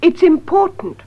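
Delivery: normal talking voice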